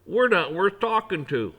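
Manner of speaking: loud mock wounded pride; sarcastic self-pity. Mocking people who act above him, loud and bitter. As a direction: fling it out bitterly.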